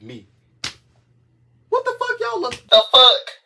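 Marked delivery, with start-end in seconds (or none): 1.7-3.4 high loud disbelief; shocked outburst. Real, not acted. Telling viewers off, loud high disbelief. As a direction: blurt it out in disbelief. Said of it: "shocked outburst".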